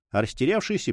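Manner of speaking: plain reading voice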